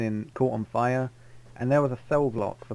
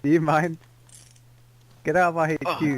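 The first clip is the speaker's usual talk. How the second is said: louder and higher, ordering him out; indignant shout at the intruder; angry; 5 semitones higher, 5 dB louder than usual. Acted. Throwing the drunk out of HQ.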